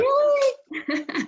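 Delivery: high, laughing surprised squeak; mock disbelief. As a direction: act amazed through a laugh. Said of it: "but I was like".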